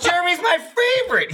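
very high, loud sing-song; mock Jeff. Imitating Jeff praising Jeremy, squeaky and high. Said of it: "whenever Jeff goes".

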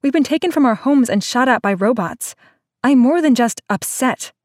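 slowing on the last words to hammer them home; indignant girl who has had enough; sputtering indignation. Acted. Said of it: "she sputtered".

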